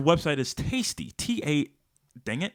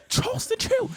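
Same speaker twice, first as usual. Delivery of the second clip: high squeaky voice. Pretend. Mock ad read squeak.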